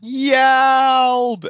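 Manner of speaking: sinking cry. Retold or imitated. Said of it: "he jumped off the ship".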